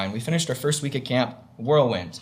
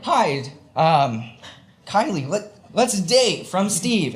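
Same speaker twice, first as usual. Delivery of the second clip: awkward dating request; 5 semitones higher, slower than usual. Retold or imitated. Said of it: "Or, like".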